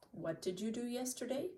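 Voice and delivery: ordinary talk, nothing marked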